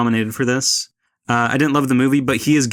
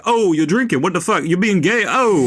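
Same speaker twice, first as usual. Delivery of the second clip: higher, hammed-up bro bartender; mock bartender; 5 semitones higher than usual. Critic mocking the bartender's constant jabs, lightly hammed-up. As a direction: lampoon the loudmouth bartender. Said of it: "the bartender being like".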